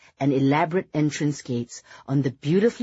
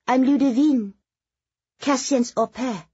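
put-on high young woman's French accent; light, high, heavily French-accented; heavy French accent; 9 semitones higher, 4 dB louder than usual. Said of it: "asked in the heavy French accent".

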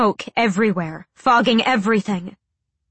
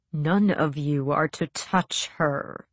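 low, slow and utterly bored; detached villain giving orders, slowly; cool, bored voice; 4 semitones lower, 5 dB quieter, slower than usual. Acted. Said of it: "a cool, bored voice was saying".

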